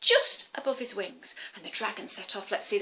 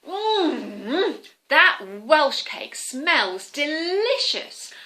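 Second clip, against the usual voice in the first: put-on old lady savouring; 4 semitones higher, 7 dB louder, much slower than usual. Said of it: "the old lady rubbed her tummy".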